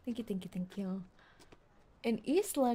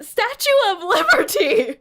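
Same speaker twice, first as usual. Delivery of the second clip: laughing; 10 semitones higher, 13 dB louder than usual. Real, not acted. Laughing disbelief at the name.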